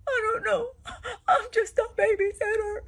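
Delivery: high, whimpering imitation of a scared babysitter; mock tearful fear. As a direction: squeak it out, pretending to cry. Scared babysitter answers angry husband.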